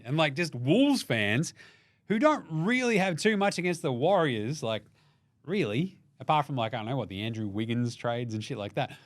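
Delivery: ordinary talk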